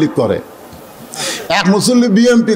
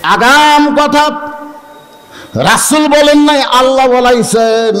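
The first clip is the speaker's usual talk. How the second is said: emphatic declaration; 5 semitones higher, slower than usual. Real, not acted. Preacher stresses Allah's word.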